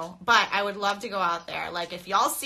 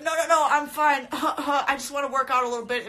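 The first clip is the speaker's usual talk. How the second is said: higher, louder, brushing off the cough; imitating a stubborn sick gym-goer, a bit hammed up; mock sick gym guy; 5 semitones higher, 4 dB louder than usual. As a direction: play the coughing guy insisting he's fine. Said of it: "And he's like".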